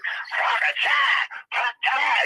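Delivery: high-pitched laughing karate yelps; high shrieked mock karate noise; mock karate cries. Mocking the gibberish, high noise.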